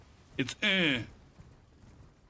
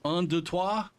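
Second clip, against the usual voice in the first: counting "Un, deux, trois" in a French accent, slightly higher; 4 semitones higher, slower than usual. Shown as a demonstration. Showing French vowels to a friend, in a French accent. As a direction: count to three like a French speaker, careful French vowels. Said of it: "you have un and un".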